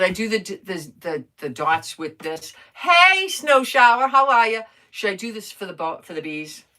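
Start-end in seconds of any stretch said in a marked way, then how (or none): 2.7-4.6 loud, high, bright hello to a viewer; excited greeting. Real, not acted. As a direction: call out a happy hello.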